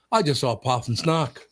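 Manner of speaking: put-on Irish kid's accent, "puff and snock" for python snake; imitated Irish accent. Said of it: "That accent is perfect".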